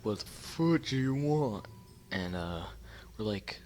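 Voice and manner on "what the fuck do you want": put-on higher, drawn-out voice of an annoyed old man; put-on old man voice, pitched up and dragged out; irritated old dude. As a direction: imitate an old dude demanding an answer, slowly. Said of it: "some old dude with".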